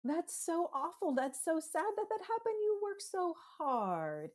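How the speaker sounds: high, slow, tender empathy; soothing parent. Shown as a demonstration. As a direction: soothe a frustrated child gently. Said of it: "and you're like".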